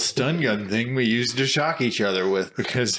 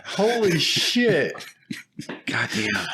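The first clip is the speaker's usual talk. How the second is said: stunned; 5 semitones higher, much slower than usual. Real, not acted. Stunned reaction.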